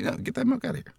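high, soft squeaky protest; little high voice refusing urgently; voice of the body. Acted. Body rejecting too much milk, high small protesting voice. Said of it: "your body's gonna be like".

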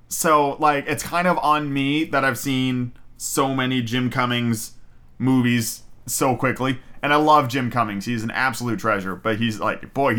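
usual speaking voice

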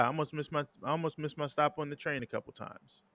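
ordinary talk

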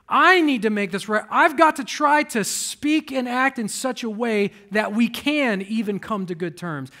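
loud, high preaching build; full-force preaching. Acted. Preacher urging church to reconcile, loud preaching. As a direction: drive it home to the congregation.

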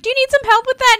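high, eager offer of help; eager helper voice. Retold or imitated. Friend offering to help carry the scarecrow, high and eager. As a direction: chirp it up to be helpful.

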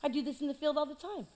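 lightly mock casual kid; casual David. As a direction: toss it off like it's nothing. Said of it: "David's like".

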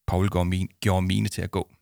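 plain reading voice

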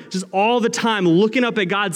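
normal talking voice

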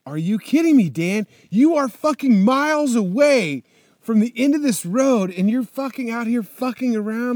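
loud, high self-scolding yell; scolding himself. Retold or imitated. Rider yelling at himself after dropping bike, exasperated. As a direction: chew yourself out, exasperated.

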